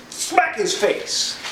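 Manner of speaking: high, slow mock order from the priest; drawn-out sneering command. High priest to his men, slow mocking order.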